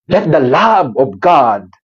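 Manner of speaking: ordinary talk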